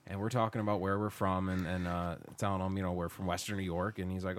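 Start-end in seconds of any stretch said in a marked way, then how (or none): none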